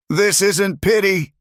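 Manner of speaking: high, slow, emphatic yell; yelling back. A narrator's reading. Man denying it is pity, yelling back raw. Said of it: "he yelled back".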